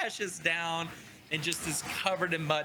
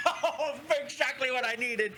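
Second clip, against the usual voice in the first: higher-pitched, through amused laughter; laughing; 7 semitones higher than usual. Acted. Game master as Brannick, amused by the squirrel's attack, laughing. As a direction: laugh your way into the words. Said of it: "Brannick just starts laughing and is like".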